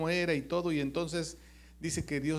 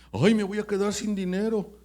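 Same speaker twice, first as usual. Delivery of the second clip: worried complaint; 6 semitones higher, 6 dB louder than usual. Retold or imitated. Worried man voiced in sermon.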